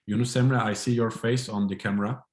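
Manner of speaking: normal talking voice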